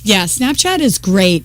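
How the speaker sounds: usual speaking voice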